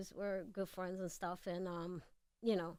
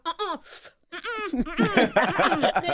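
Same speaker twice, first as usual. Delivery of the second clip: laughing imitation; 7 semitones higher, 11 dB louder than usual. Imitating Pac's reaction, laughing.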